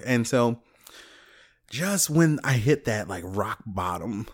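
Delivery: usual speaking voice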